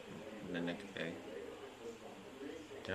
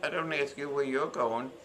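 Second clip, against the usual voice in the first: snappy retort; 3 semitones higher, 4 dB louder than usual. Retold or imitated. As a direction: snap back at a nosy neighbour. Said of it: "I said".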